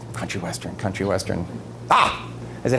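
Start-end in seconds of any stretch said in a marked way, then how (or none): 0.1-1.4 low, hushed musing; pondering to himself. Retold or imitated. Whistler hunting for a song to suit the president, pondering quietly. Retold thinking to himself after the request. 1.9-2.3 high, short "ah!" of discovery; sudden realisation gasp. Retold or imitated. Whistler suddenly finding a song for the president, bright-idea exclamation. Idea strikes after pondering.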